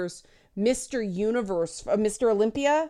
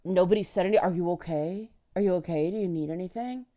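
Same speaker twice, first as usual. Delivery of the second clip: softer, gently fussing voice; mock concerned coddling; 2 semitones lower, 4 dB quieter, much faster than usual. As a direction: fuss over her like a worried nanny. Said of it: "it was all".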